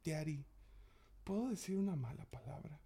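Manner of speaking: put-on high, soft child's voice asking permission; sweet little kid. Dad imitating his son asking to swear, sweet and soft. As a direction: ask sweetly like a small boy. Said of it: "así súper dulce".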